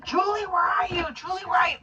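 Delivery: high frantic calling out; screaming for Julie. Retold or imitated. Man searching the house screams for his wife, panicked yell. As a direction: yell her name in panic. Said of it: "he's screaming for Julie".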